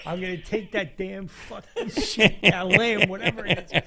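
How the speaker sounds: half-laughing loud menace; mock threat.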